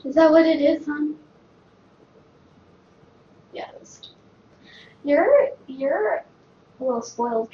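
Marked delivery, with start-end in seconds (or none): 3.5-4.0 soft whispered yes; whispering. Real, not acted. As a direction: whisper the answer. Whispered reply.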